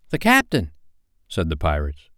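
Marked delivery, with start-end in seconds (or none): 0.1-0.7 high, startled pirate exclamation; astonished, voice pitched up; surprised. A narrator's reading. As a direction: blurt it in startled recognition. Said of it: "staring at each other in surprise".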